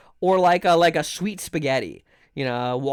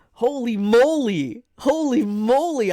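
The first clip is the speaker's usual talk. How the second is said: slow, drawn-out holy moly in disbelief; stunned; 5 semitones higher, 3 dB louder, slower than usual. Real, not acted. Reacting to a strange dish, slow and stunned. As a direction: let the shock stretch the words out.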